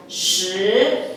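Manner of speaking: "shi" drawn out with a rising tone; Mandarin pronunciation demo. Shown as a demonstration. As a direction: model "shi" as one long rising syllable. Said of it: "Sepuluh di bahasa Mandarin adalah". ("shi" panjang dengan nada naik)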